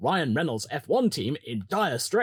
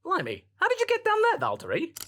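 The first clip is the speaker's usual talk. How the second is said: mock disbelief. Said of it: "looked at Valtteri's position and went".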